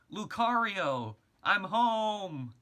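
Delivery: loud high call across the house; shouting. A narrator's reading. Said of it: "Chris shouted".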